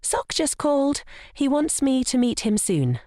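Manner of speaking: high, sugary sweet voice; sweetly sugar-coat every word. Acted. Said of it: "with another sweet smile".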